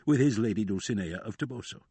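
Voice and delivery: ordinary delivery, nothing marked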